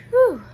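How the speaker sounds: loud, high sigh of relief; relieved whew. A narrator's reading. Pete, relieved Bigfoot isn't real, a loud high whew. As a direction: let the relief burst out. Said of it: "Pete sighs with relief".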